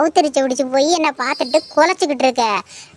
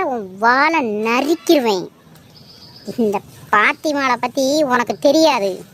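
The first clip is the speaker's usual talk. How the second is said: usual speaking voice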